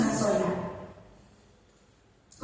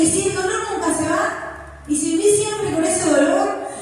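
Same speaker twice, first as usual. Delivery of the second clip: higher, anxious questioning; fearful what-if voice; 6 semitones higher than usual. Retold or imitated. Preacher voices fear whispering doubts about pain. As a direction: needle with what-ifs.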